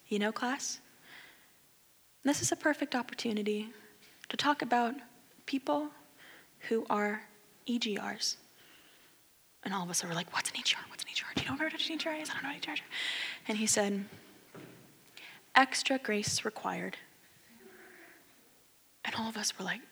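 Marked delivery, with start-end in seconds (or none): 0.1-8.4 a professor's gentle, unhurried voice. Retold or imitated. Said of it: "he just talks really gentle". 10.3-12.8 whispered questions between classmates; quiet puzzled whispering; whispered confusion. Retold or imitated. Students whispering to each other. 15.5-17.0 soft, slow and calm; a calm, gentle professor's answer. Retold or imitated. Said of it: "he just talks really gentle".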